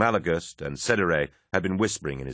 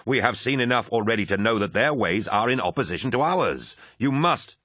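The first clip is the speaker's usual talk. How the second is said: angry demand; 5 semitones higher than usual. A narrator's reading. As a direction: push hard against your commander. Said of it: "Abaddon snarled".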